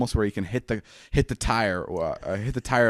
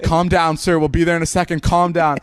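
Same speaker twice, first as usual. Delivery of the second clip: urgent calming-down; 7 semitones higher, 6 dB louder than usual. Retold or imitated. Police calming a panicking caller.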